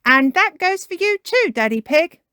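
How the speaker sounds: high, loud pointed warning; Miss Rabbit. Acted. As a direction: aim the warning at the culprit. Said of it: "says Miss Rabbit".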